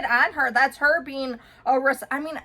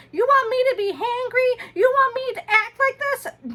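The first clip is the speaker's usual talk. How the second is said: much higher, whiny mock tantrum; hammed-up kid tantrum voice; mimicking whining son; 11 semitones higher, 4 dB louder than usual. Mom imitating her son's tantrum, high and whiny. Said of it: "he's kicking and screaming".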